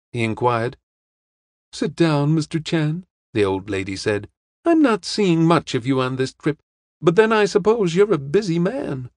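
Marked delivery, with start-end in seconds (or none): 1.7-9.1 put-on higher-pitched, chatty old lady. Old lady invites detective to sit, chatty and higher.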